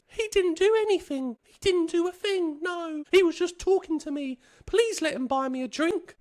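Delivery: high, pleading woman's voice; girl defending him. Retold or imitated. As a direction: stick up for him, pitched high. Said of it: "she did defend me".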